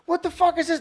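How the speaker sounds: shrill hype yell of a movie fan; excited disbelief. Retold or imitated. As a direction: blurt it out like the trailer just blew your mind. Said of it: "I was like".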